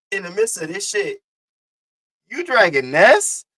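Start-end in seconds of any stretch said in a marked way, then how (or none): 2.3-3.5 loud, slow, high rising disbelief; incredulous question. Real, not acted. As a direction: challenge it in disbelief, pitch climbing. Incredulous challenge to Reed.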